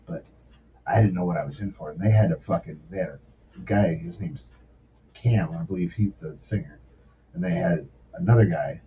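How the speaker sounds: usual speaking voice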